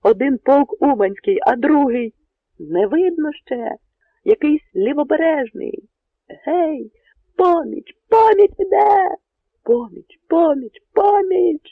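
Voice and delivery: high, loud, exultant crying out; joyful powerful shouts. Acted. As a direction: roar the good news across the ramparts. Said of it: "Радісно й потужно загукали".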